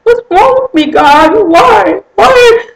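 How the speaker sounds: high, loud anguished cry; sobbing plea to God. Retold or imitated. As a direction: wail it up to God in despair. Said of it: "I cried out to God".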